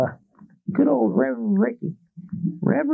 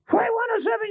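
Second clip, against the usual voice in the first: higher, pressing radio call; urgent controller call; 8 semitones higher, faster than usual. Retold or imitated. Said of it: "some guy sitting in a tower".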